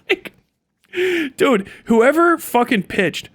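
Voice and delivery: ordinary talk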